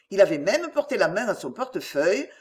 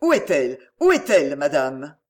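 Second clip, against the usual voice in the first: worried father demanding an answer, louder on the repeat; urgent demand; 6 semitones lower, 5 dB louder than usual. Acted. Father begging a visitor for his daughter's whereabouts, urgent.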